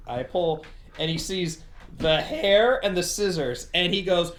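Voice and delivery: ordinary talk, nothing marked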